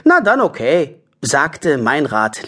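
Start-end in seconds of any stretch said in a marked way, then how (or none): 0.0-1.0 giving-in character voice. Acted. Passer-by gives in, story.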